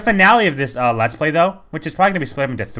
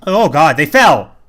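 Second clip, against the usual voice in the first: louder, raised burst of surprise; startled exclamation; 3 semitones higher, 7 dB louder, slower than usual. Real, not acted. Player seeing characters suddenly fall, real loud surprise. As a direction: break off, jolted and loud.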